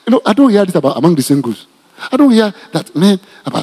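ordinary talk, nothing marked

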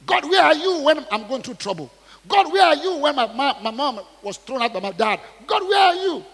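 high loud anguished cry out to God; desperate cry. Retold or imitated. A suffering believer crying out to God, anguished and loud. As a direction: cry out, begging where God is.

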